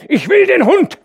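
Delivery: very high, loud demanding yell; furious shout. A narrator's reading. Hauke shouting demands for the dog. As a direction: bellow with stress on will. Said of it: "schrie er".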